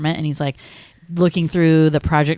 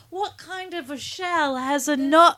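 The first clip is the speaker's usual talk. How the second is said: high, slow, put-on silly voice; movie character quote; 10 semitones higher, slower than usual. Quoting the nutshell line again, high and slow. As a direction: pitch it up and draw it out. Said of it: "he's like".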